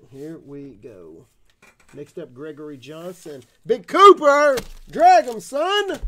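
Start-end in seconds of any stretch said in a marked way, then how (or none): 3.7-6.0 high, loud yell; hyped shout. Real, not acted. Yelling to friends over a big pull.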